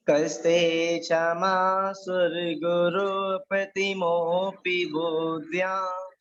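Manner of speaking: slow, measured chanting of a Sanskrit shloka; teacher intoning a shloka line by line; verse recitation. Shown as a demonstration. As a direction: recite the verse slowly for the class to repeat.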